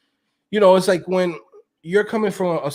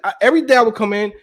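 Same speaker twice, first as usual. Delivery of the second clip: usual speaking voice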